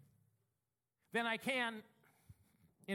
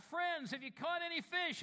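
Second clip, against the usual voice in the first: calling out; 7 semitones higher than usual. Acted. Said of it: "he called out".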